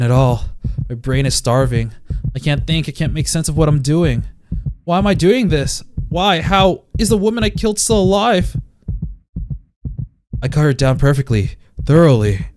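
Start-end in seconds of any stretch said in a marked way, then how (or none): 4.8-8.5 desperate. Acted. Desperate narration.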